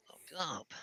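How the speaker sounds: breathy whisper, lock that up. Acted. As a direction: whisper it to yourself. Repeats the phrase, then whispers it.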